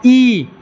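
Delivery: long held ई, tongue up at the palate; isolated, sustained ई; palate-sound demo. Shown as a demonstration. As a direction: hold ई out for the class. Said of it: "अब जब ये बोलोगे ई".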